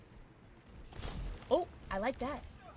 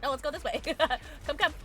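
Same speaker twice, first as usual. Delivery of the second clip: usual speaking voice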